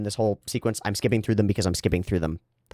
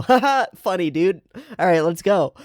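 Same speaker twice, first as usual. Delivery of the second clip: mock breezy reply; 10 semitones higher, 4 dB louder than usual. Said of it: "And you go".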